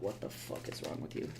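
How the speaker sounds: a hushed, breathy mock viewer; whispered viewer reaction; imitated viewer whisper. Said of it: "is gonna be like".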